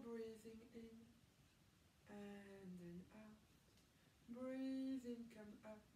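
soft, slow breathing cues; slow counted breathing coach. Acted. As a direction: stretch each cue over the breath.

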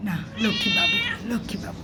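high soft coo, then sinking to a hush; calm the cat with a gentle coo. Real, not acted. Soothing a stray cat that might swipe, soft coo.

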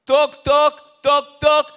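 loud, high spoken knock-knock; knocking sound. Acted. Preacher knocking at the believer's door, loud knock-knock. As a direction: rap out each toc loudly. Acts out knocking, second time.

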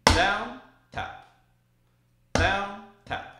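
counting strokes aloud, DOWN loud and tap soft. Shown as a demonstration. Drum teacher calling strokes, DOWN loud and tap soft. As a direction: call DOWN loud then a quiet tap. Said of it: "we'll do it again".

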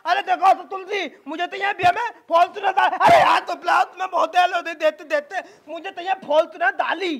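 lisping son stubbornly reeling off demands; childish lisp. Acted. Groom lists his dowry demands, lisping. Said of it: "ये तो तोतला है".

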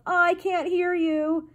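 high, slow chanting call; drawn-out shout. A narrator's reading. King refusing to listen to the mouse, drawn-out shout. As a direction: call it out high, stretching the words. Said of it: "He stopped to shout".

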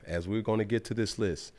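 usual speaking voice

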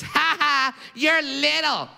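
high, jeering playground taunt; taunting kid. Retold or imitated. Bully pointing at a small classmate, taunting. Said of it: "points his finger in my face".

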